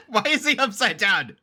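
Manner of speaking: high, laughing question; real laughter. Real, not acted. Viewer spotting Bricky upside down, high laughing disbelief. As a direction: crack up, then ask through the laugh.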